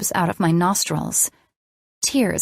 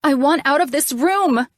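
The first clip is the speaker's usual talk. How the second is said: high, loud, desperate demand; shouting; 8 semitones higher, 3 dB louder than usual. A narrator's reading. Scared patient shouting to leave the room, high and loud. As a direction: cry out to be let out. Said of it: "I shouted back".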